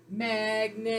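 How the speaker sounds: MAGNET drawn out long on a level pitch; blended and held. Shown as a demonstration. Teacher models blending the sounds for pupils, blended and held. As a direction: hold both vowels of MAGNET in one slow blend. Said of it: "Blend and hold".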